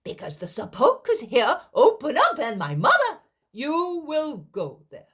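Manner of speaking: high, loud, urgent pleading; emphatic insistence. Acted. Speaker urging the sick man toward the sepulchre, loud and high. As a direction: press the point hard and high.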